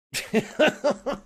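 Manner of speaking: a sudden high, sharp scoffing laugh; loud, mocking burst of laughter; bitter high laugh. Real, not acted. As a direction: laugh out loud in disbelief at them.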